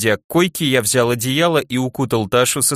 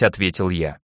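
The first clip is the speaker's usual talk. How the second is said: plain reading voice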